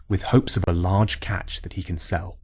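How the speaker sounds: usual speaking voice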